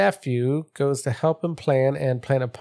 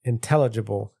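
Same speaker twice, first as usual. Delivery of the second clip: ordinary delivery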